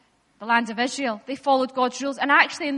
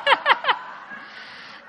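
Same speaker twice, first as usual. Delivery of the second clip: high airy laugh; 12 semitones higher than usual. Real, not acted. Laughs at a joke.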